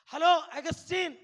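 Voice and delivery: loud, slow call across the street. Retold or imitated. Mother calling her son across the road, loud and drawn out. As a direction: call his name from a distance, drawn out.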